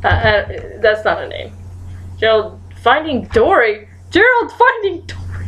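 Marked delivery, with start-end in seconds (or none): 4.1-5.4 high-pitched exasperated cry; insistent repeat. Real, not acted.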